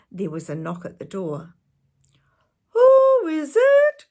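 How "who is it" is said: wolf faking a very high, loud granny; high little voice. Acted. Wolf in bed pretending to be grandmother, very high. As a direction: squeal it in a put-on old lady voice. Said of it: "in a high little voice".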